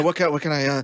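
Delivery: bumbling, halting offer of help; flustered, trailing-off deference; Hugh Grant. Retold or imitated. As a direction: stammer politely and lose the thread. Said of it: "upped my, like, Hugh Grant levels".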